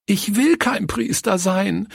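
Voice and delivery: put-on high-pitched tearful little girl; acted high, weepy wail; sobbing child's cry. A narrator's reading. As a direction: cry out in protest like an upset child. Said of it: "rief sie schluchzend".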